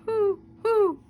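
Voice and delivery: high, loud hooting owl. Acted. Owl in the attic warning off the bats, hooting.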